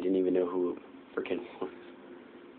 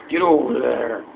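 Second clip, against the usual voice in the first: loud, higher yell on "Get over there"; shouted there; 5 semitones higher, 11 dB louder, slower than usual. Shown as a demonstration. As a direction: bark the word over out loud. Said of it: "Instead of".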